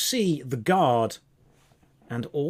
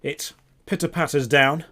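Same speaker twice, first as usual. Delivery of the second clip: ordinary talk, nothing marked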